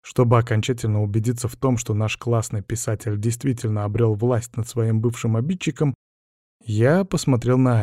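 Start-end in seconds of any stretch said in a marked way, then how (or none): none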